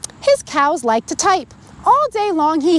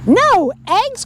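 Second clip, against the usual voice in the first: higher, startled "No eggs?"; a farmer who can't believe it; shocked cry; 8 semitones higher than usual. A narrator's reading. Farmer Brown hearing the cows' note, startled cry. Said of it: "cried Farmer Brown".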